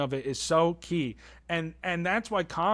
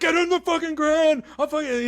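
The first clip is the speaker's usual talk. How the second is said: very high, louder threat; hammed-up cop-style threat; mock angry shout; 11 semitones higher, 4 dB louder than usual. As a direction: yell at a guy to hit the ground. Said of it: "he's like".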